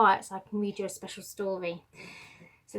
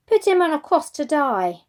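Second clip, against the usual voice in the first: higher, louder demand; crowd shout; 6 semitones higher, 10 dB louder than usual. Acted. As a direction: yell it up at Pilate. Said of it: "they shouted".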